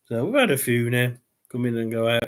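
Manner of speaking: normal talking voice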